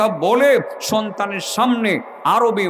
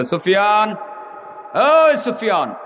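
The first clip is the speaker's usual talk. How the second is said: high, calling-out rebuke; acted high-pitched challenge from an unseen voice; commanding dream voice; 5 semitones higher than usual. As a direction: call to a sleeper, then scold him. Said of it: "কে যেন তাকে বলতেছিল".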